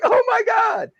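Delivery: retold alarm, voice shooting up high; shocked oh my God. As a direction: gasp it out in disbelief while filming. His reaction filming dog attack.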